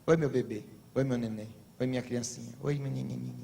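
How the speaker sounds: tratamento infantil de casal, mais grave e baixo; voz de bebê. Retold or imitated. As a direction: imitando apelidos carinhosos de casal, suave. (casal chamando um ao outro de bebê, voz baixinha e carinhosa)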